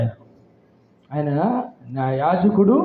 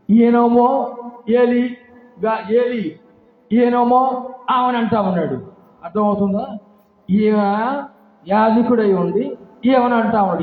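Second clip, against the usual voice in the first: preaching at full force; 6 semitones higher, 3 dB louder than usual. Real, not acted.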